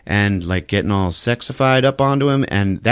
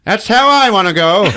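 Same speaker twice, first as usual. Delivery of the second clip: normal talking voice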